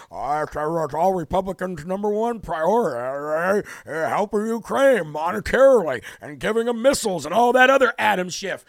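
mock the senate leader, lightly voiced; Mitch McConnell impression. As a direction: put on a sneering politician's voice. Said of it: "Mitch McChina, coming out yesterday saying".

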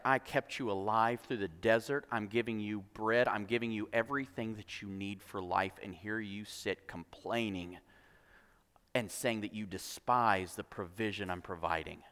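low, hushed, restrained anger; quiet anger. Acted. Lord rebukes complaining people he fed, quietly angry. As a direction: voice the Lord's anger very softly and deep. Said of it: "it aroused the Lord's anger".

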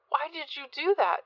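high-pitched, mildly shocked question. A narrator's reading. Addie to Rich after the blow, mildly shocked. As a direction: ask it high, a touch aghast.

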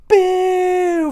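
long, high-pitched crowd boo; loud booing. Retold or imitated. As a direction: boo loudly from the rafters. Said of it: "we would have been booing at this".

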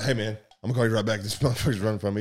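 fast, hurried phone reply; officer rushing a call mid chase; rushed. Retold or imitated. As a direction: rattle it off while chasing.

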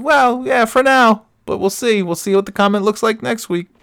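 high, sly mock warning; high teasing hedge. Dad teasing the kid about the marriage, high and sly. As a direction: tease it up high, drawing it out.

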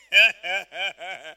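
long, loud put-on belly laugh; imitated hearty laugh. Imitating Papa Hagin at camp meetings, loud rolling laugh. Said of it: "He will just stand like this and say".

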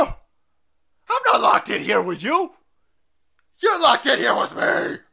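slow, menacing movie-line delivery building to a shout; Rorschach quote. Pretend. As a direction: growl the threat, then yell the last line.

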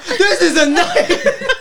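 high, loud cry through laughter; laughing outcry. Acted. Game master on lawyer yelling from the court, high laughing outcry. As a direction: wail it loudly while laughing. GM voices the scene's absurdity, laughing.

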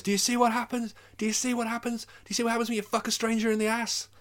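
imitating John Goodman's angry repeated rant. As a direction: yell it like a furious man smashing a car. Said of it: "and he goes".

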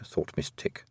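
usual speaking voice

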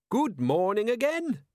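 high, drawn-out cheery call; bright greeting. A narrator's reading. Captain hailing the robbers from the cart, brightly. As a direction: call out brightly, pitched well up and slow. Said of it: "he said brightly".

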